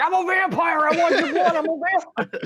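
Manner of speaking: high, manic mock shouting; hammed-up unhinged vampire impression, shouted way up high; Nick Cage in Vampire's Kiss. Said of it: "Nick Cage from Vampire's Kiss".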